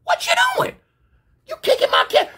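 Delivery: very high-pitched frantic holler; reenacted shrieking disbelief; panicked protest. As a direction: squeal at someone to stop. Acted panicked protest to kicker.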